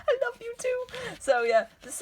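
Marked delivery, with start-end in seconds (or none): 0.1-0.9 much higher, dreamy lovestruck squeak; playfully voicing the heroine's reply in a high swoon. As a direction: gush it like a smitten teen.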